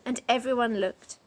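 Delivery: ordinary delivery, nothing marked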